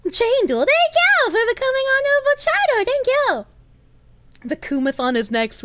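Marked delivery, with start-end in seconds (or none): 0.1-3.5 high, bright cartoon thanks. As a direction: thank them in a squeaky cartoon voice. High put-on thank you.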